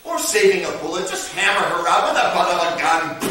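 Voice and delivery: plain reading voice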